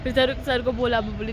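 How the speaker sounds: ordinary talk, nothing marked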